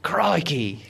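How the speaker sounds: one-word burst of hammed-up Aussie; mock Australian accent. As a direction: put on a hammy Australian accent. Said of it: "done it with an Australian accent".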